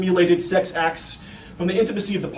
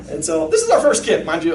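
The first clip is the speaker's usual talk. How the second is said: ordinary delivery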